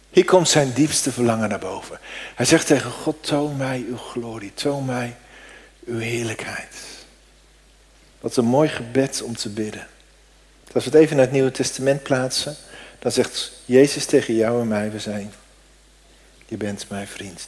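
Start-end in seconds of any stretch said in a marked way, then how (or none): 3.2-6.8 soft, much quieter longing plea; a hushed, yearning request to God; hushed prayer. Acted. Intimate prayer voiced softly. 14.9-17.5 tender whisper-soft. Acted. Jesus' words said tenderly.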